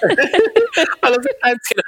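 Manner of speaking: giggling gleefully at the memory; laughing. Real, not acted. Host recalling the canteen to co-host, laughing.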